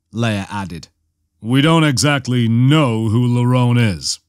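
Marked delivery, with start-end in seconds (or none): 1.4-4.2 understated grim admission. A narrator's reading. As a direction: admit it reluctantly, grimly. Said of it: "Han said grimly".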